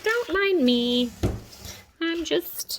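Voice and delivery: loud and slow, drawn out; loud, slow aside. Real, not acted. To viewers while hobbling on a crutch, loud slow aside. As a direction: say it loud and slowly as you hobble.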